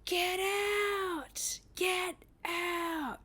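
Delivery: high, drawn-out mock scream; stretched-out retold night-terror cry; imitated screaming. Imitating husband screaming into the hallway in his sleep, mock scream. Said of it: "and he's screaming".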